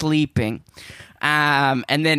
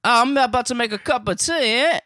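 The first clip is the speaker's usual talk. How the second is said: put-on higher, quick British teen offering tea; chirpy British teen voice, offering a cuppa; British accent, teen offering tea; British teenager accent; 7 semitones higher than usual. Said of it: "a British teenager offering you tea".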